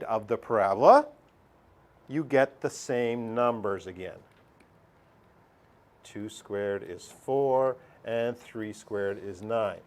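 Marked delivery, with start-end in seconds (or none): 6.0-9.8 slow teacher demo. Shown as a demonstration. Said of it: "teacher slows to show".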